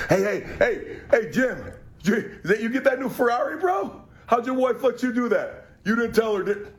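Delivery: hammed-up rich bro at the country club. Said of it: "here's my impression of country club people".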